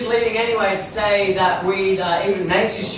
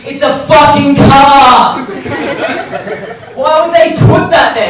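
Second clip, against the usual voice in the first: incredulous shout; 7 semitones higher, 9 dB louder than usual. Real, not acted. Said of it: "incredulous rant at the ad".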